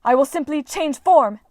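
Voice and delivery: high, loud defiant cry; furious goddess crying out. A narrator's reading. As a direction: shout the threat at him. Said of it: "she cried".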